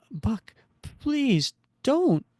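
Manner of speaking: high breathy plea, rising; frightened plea. Acted. Betty begging Buck with the knife out, high frightened plea. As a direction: beg him not to, scared.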